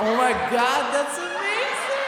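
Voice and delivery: very high, loud mock squeal; gushing fans. Locals hearing his American accent, mock ecstatic squeal. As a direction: squeal it like an over-impressed fan. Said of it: "And they're like".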